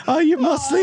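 high, strained cartoon voice; Family Guy character voice. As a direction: squeak it out like the cartoon. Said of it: "that character from Family Guy".

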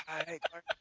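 very soft, strained rasp; barely able to talk. Retold or imitated. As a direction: imitate a shot voice trying to answer. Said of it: "He can barely talk".

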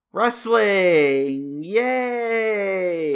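loud, high mock celebration; sarcastic cheer. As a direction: cheer it with bitter irony. Sarcastic cheer after a grim fact.

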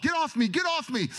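pushing people away. Retold or imitated. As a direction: shove them off with your voice.

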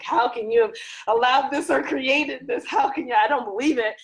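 high, loud self-scolding; scolding herself. Retold or imitated. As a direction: berate yourself in disbelief. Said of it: "I'm like".

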